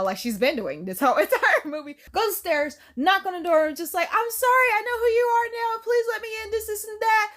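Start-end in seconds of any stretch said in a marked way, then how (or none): 4.1-7.3 high-pitched mock plea; imitated frantic apology, pitched up; urgent pleading. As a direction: beg to be let in, acted out. Acting girl pleading at door.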